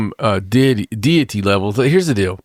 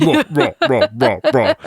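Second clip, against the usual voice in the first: short, repeated mock roars; imitated giant's roaring; monster roar; 4 semitones higher than usual. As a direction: roar in a string of short bursts. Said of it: "you can hear".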